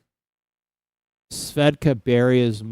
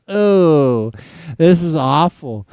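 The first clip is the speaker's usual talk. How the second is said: loud, high mock groan of disgust; playful imitated disgust; 4 semitones higher, 8 dB louder, slower than usual. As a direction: act out the awful aftertaste with a raised voice. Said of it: "you're like".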